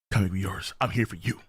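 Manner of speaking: a hint of a gentle hero; low soft character voice. Voicing manga character lines.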